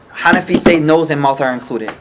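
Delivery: usual speaking voice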